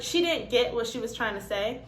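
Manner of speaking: ordinary talk, nothing marked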